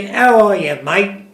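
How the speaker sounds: a hint of Australian twang; cheery imitation of an Aussie greeting; Australian accent. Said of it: "if you took a baby and brought him up in Australia".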